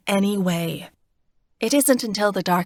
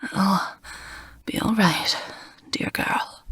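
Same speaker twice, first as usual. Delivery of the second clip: breathless, fading to a whisper; gasping for breath. Acted. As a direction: push words out between breaths. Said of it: "he gasps for breath".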